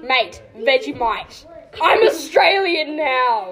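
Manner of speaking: slow, stretched-out mock Aussie; hammed-up Aussie drawl with a laugh; Australian accent. Shown as a demonstration. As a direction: drag out every word in broad Australian. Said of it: "say the most Australian thing".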